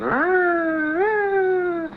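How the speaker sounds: a high, loud siren-like "rah, rah" yell; imitated siren wail. Recruit proving an emergency to the drill instructor, high siren wail. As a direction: wail it like a siren while running laps. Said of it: "go around the room three times with your siren".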